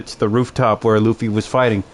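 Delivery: usual speaking voice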